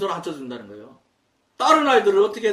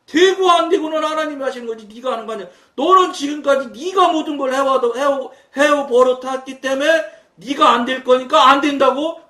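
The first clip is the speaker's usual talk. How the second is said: raised, insistent lecturing of a student; a loud retold rebuke, half stern; retold scolding; 7 semitones higher, 7 dB louder than usual. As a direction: push back hard on the boy's doubt. Teacher scolding doubting student.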